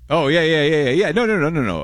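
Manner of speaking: high, loud, rapid-fire repeats; flustered blurting. Real, not acted. Host scrambling after a name mix-up, high flustered blurting.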